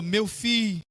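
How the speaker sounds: understated grandmotherly entreaty, hushed; tearful pleading. Retold or imitated. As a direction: plead gently, with a touch of tears. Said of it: "Se ajoelhou diante de mim chorando".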